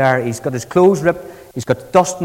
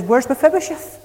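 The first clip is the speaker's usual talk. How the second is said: surprised question; 8 semitones higher than usual. Retold or imitated. David demanding his master's whereabouts.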